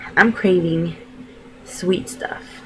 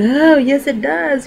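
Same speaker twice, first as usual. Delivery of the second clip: higher, louder pained insistence; a half-joking cry of protest; mock protest; 8 semitones higher, 5 dB louder, slower than usual. Answering the doctor about the cervix check, higher mock protest.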